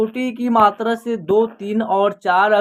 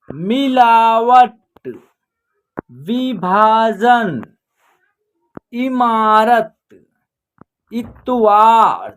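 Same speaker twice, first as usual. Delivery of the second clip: slow, each word spaced out; slow word list; much slower than usual. Shown as a demonstration. Teacher reading a word list aloud to the class, slowly. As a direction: read the list slowly for pupils. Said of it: "देखिए यहाँ छोटी की मात्रा".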